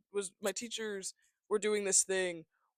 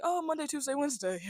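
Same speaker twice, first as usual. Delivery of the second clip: mock innocent kid; 9 semitones higher than usual. Young self's lie voiced.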